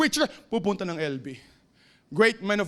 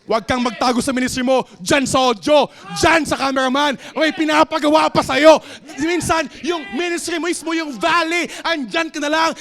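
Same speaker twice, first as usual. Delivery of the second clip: loud, rising preacher's cry, louder each line; fiery preaching; 5 semitones higher, 8 dB louder than usual. Acted. Pastor preaching to the congregation, full-force shouting.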